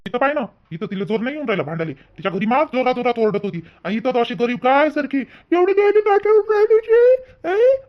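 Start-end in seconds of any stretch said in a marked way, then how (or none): 5.5-7.8 high, shrill-free mock shout imitating the wife; mock yelling. Husband imitating his wife yelling at him, high loud mock shout. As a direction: bawl it out as she did. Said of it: "अशी बोंबलून राहिली".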